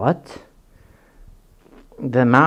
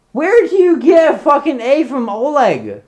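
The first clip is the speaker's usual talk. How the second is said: high, loud incredulous question; acted-out indignation, pitched way up; exasperated outburst; 12 semitones higher, 6 dB louder than usual. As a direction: demand it in hammed-up disbelief. Acted exasperation at misspelling of name.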